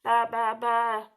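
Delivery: loud repeated baas; sheep bleats. A narrator's reading. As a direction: imitate a sheep bleating in a row. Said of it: "said the big fat red hen".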